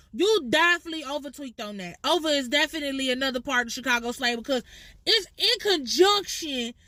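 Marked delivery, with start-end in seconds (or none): none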